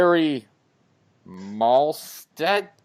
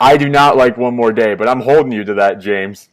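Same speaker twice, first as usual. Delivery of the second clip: loud insistence; 10 dB louder than usual. Real, not acted. Cohost holding James to his promise, loud and firm. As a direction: declare it firmly to hold him to it.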